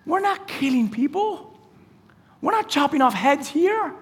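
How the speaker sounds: high, restrained scolding; high-pitched, incredulous telling-off; exasperated rebuke. Acted. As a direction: rebuke a friend in disbelief, without raising volume. Jesus acted out rebuking Peter.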